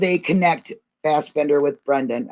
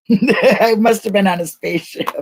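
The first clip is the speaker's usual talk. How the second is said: laughing while speaking; 5 semitones higher than usual. Real, not acted. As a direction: chuckle through the line. Laughing after stream glitch.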